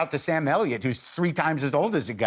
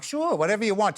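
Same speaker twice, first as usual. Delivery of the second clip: higher-pitched, agreeable go-along voice; mock-accommodating, pitched up; easygoing compliance; 7 semitones higher than usual. Shown as a demonstration. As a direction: breezily give in to whatever they want. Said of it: "I can go from, like".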